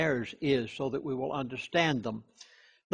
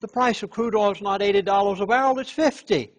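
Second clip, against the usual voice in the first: amused insider; 6 semitones higher, 6 dB louder than usual. Retold or imitated. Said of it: "And he said".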